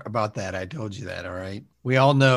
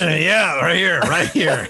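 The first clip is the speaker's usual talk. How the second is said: laughing objection; 5 semitones higher, 6 dB louder, slower than usual. Acted.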